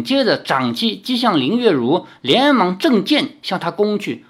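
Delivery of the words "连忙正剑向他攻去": usual speaking voice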